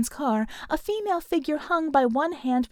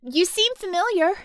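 high, loud suspicion; demanding; 8 semitones higher, 4 dB louder than usual. A narrator's reading. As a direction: accuse them, voice pitched up. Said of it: "Harmony demanded".

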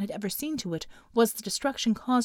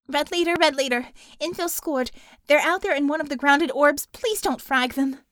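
urgent radio call; 5 semitones higher, 4 dB louder than usual. A narrator's reading. Urgent call over comms.

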